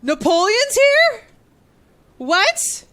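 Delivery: high, loud, slow disbelief; stunned surprise. Real, not acted. Streamer hears the game announce Napoleon and shrieks in surprise. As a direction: shriek it in amazement.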